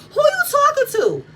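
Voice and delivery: high, sharp imitation of Destiny's "who you talking to"; high mock challenge. Destiny confronting Martell over disrespecting Nell, high mock challenge. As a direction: snap it up high like a protective friend. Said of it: "Destiny yanked Martell's hoodie and said".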